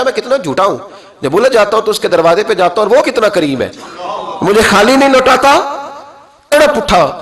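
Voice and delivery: high, loud impassioned storytelling; climactic preaching. Acted. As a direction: carry the crowd to the climax. (بلند آواز پرجوش وعظ)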